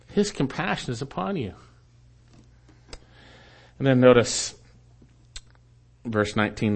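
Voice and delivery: ordinary talk, nothing marked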